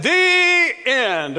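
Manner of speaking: high, loud sing-song "the end"; mock congregation. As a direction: ham up the congregation's chorus. Mocking congregation closing prayer.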